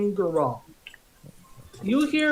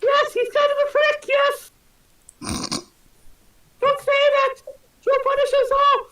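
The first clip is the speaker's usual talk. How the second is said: higher, excited worker voice; startled worker; 4 dB louder than usual. Acted. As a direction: gasp it out like a shocked labourer.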